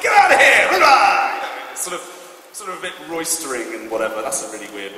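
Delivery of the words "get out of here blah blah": loud, high bellowed scolding; mock father's shout. Father roaring at his son in the scene, hammed-up shout. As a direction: bellow it like an angry dad throwing him out. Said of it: "It's father and son".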